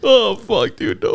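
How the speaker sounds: high, sudden oh of dismay; dismayed outburst. Real, not acted. Co-host hearing about lowballing, high dismayed yelp. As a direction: yelp it like bad news landed.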